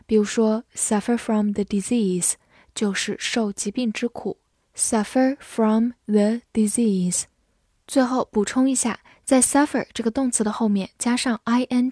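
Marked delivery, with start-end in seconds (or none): 4.8-7.2 slow, careful model phrase; slow repeat. Shown as a demonstration. Teacher repeats the phrase slowly for learners, slow and clear. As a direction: say it slowly so students follow. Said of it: "example repeated slowly".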